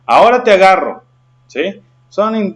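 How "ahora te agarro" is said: "Ahora te agarro" exclaimed loud and high; exclamatory intonation. Shown as a demonstration. Teacher shows how an exclamation mark sounds on "Ahora te agarro", exclamatory intonation. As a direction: throw out "Ahora te agarro" as a raised, forceful exclamation. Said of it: "de admiración".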